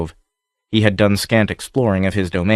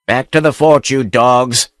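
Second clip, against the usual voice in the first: higher, louder yelled order; 3 semitones higher, 4 dB louder than usual. A narrator's reading. Buccaneer yelling his men back to the fort, loud. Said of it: "rose to a yell".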